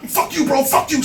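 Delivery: mad guy yelling. Retold or imitated. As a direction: bark it like a hothead. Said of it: "the aggressive asshole's gonna get mad".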